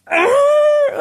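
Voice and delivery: high loud frustrated argh; sudden frustrated yell; frustrated growl. Retold or imitated. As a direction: let out an exasperated argh. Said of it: "I was like".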